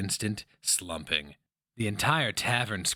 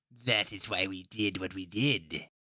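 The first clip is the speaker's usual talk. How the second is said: put-on old woman. A narrator's reading. Old woman voiced in story.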